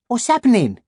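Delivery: put-on high young boy voice; boy character voice. A narrator's reading. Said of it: "said Freddie".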